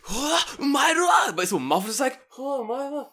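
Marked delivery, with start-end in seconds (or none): 0.1-1.4 slow, high shouted cartoon cry; imitating One Piece blaring in his headphone, hammed-up yell; anime scream. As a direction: mimic a cartoon character screaming. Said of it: "you just hear".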